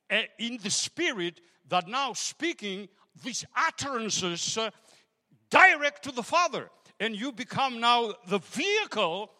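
loud, rising preacher's call; full-force preaching. Acted. Pastor rousing the church to pray in tongues, preaching loudly. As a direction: drive it home to the congregation, building to Hallelujah.

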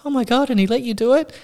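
very high-pitched, gossipy amazement; mock amazed wife. Surgeon's wife hearing the dinner story, high mock amazement. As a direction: squeal it like an astonished wife.

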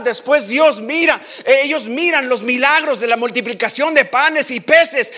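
high, loud fervent preaching; preaching at full force. Real, not acted. Preacher driving the story home to the church, fervent preaching. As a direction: preach it with rising fire.

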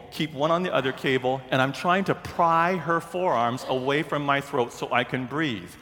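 normal talking voice

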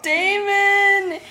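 high, drawn-out call of the name; high cry. Acted. Reader voicing Laurent to Damon, high pleading cry. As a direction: cry the name out in hammed-up dismay.

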